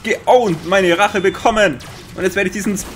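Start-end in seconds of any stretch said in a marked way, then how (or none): 0.0-1.8 loud, higher, gloating over the kill; triumphant gloat. Real, not acted. Player gloats after taking revenge on the enemy, triumphant. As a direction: crow over your revenge.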